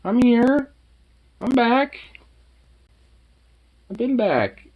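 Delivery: bright, high voice for the dog; sing-song talk to a pet. Real, not acted. Talking to the dog.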